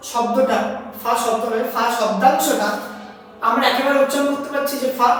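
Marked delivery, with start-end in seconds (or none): none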